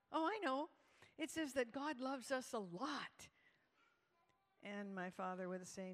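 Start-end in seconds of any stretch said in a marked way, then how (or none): 0.1-3.3 imitated child's high, bright-idea answer; eager child. Daughter answers father's verse quiz, mock eager. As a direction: pipe up high, sure you know it.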